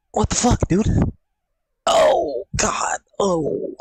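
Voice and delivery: high, strained cry of pain; a real yelp of back pain. Real, not acted. Pain while standing up.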